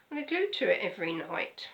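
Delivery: ordinary delivery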